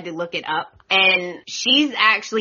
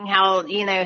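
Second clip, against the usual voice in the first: normal talking voice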